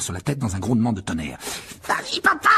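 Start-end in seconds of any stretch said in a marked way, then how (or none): 1.9-2.5 put-on very high boyish yell; excited cheer. A narrator's reading. Fred and George cheer their father's brawl, yelling excitedly. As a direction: egg your dad on in a fight. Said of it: "s'écrièrent Fred et George".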